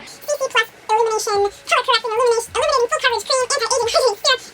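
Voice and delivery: high, fast mock commercial read; rapid ad announcer. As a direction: rattle off the product name like an ad. Rattling off long product name.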